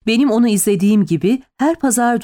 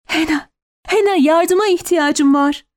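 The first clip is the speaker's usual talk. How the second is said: high-pitched cry for help; desperate plea; 8 semitones higher than usual. A narrator's reading. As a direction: beg for help, voice raised in pitch. Woman calls desperately for help.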